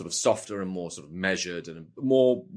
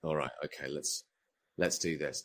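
nervous resignation; 6 semitones lower, 9 dB quieter than usual. Retold or imitated. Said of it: "And you're like".

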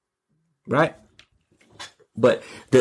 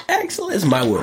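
high catchphrase; 9 semitones higher than usual. Acted. Catchphrase first take.

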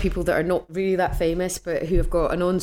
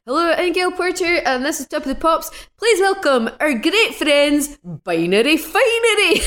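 badly imitated Scottish accent; 11 semitones higher, 5 dB louder than usual. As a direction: ham up a terrible Scottish accent, stretching the words. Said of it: "she does my s-, accent really badly".

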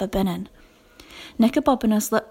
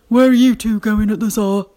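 louder, gruff official challenge; stern night watchman; 7 dB louder than usual. A narrator's reading. Night watchman challenging two children out at dawn, stern and loud. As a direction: bark it like a guard on patrol.

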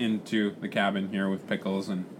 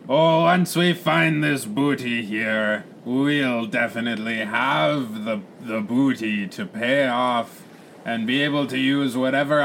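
hearty pirate captain; much slower than usual. Acted. Captain asks first mate about the situation, hearty pirate. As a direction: roll out the Rs like a swaggering pirate.

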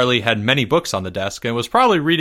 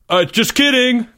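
high, louder blurted retraction; bursting out; 12 semitones higher, 5 dB louder than usual. A narrator's reading. Boy hurriedly taking back his answer, bursting out. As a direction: burst out in a much higher voice. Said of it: "Greg burst out".

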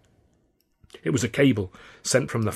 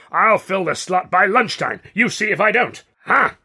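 outraged, increasingly pitched-up rant; angry ultimatum; 5 semitones higher, 4 dB louder than usual. A narrator's reading. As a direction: bluster rising to high, loud defiance. Considine's angry ultimatum, building.

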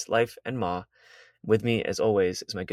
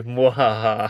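mock villain cackle; hammy evil mwahaha; evil laugh; 3 dB louder, slower than usual.

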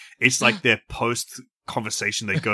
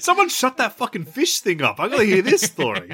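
higher-pitched, urgent heckle; mock irritated demand; 7 semitones higher than usual. Audience member wanting to hear the story, impatient. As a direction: call out over the show, comically impatient.

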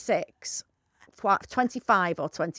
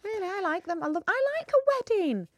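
gushing; 10 semitones higher than usual. Real, not acted. Gushing about weddings.

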